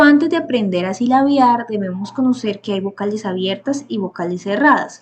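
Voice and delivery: ordinary talk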